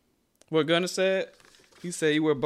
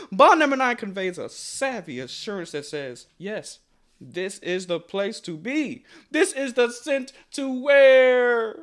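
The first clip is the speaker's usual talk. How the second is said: higher, louder luxury commercial read; grand ad voice; 4 semitones higher, 4 dB louder than usual. Acted. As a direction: sell New York like a perfume ad.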